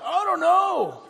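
high-pitched mock-dumb reply; clueless protester. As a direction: answer like a protester without a clue. Acts clueless protester answering interviewer.

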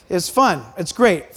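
ordinary talk

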